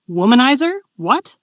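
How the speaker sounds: disbelief. A narrator's reading. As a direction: throw it back as a baffled question. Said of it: "he asked in disbelief".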